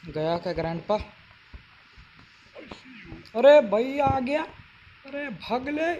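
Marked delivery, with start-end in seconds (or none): 3.4-5.9 high, frightened yelp; panicked shout. Real, not acted. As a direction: panic as grandpa appears. Grandpa appears, panicked.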